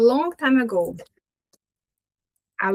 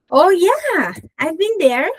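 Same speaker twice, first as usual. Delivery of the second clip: high, louder, eager "oh yeah" answer; enthusiastic example reply; 7 semitones higher, 4 dB louder, slower than usual. Acted. Teacher acting out a traveller's answer, enthusiastic. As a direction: answer brightly, as if pleased to be asked.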